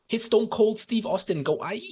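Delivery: high, slow mock Chinese accent. Streamer recalling The Rock's WWE line, mock Chinese accent. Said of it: "In, like, a very racist Chinese voice".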